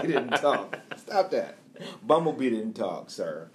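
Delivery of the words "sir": ordinary delivery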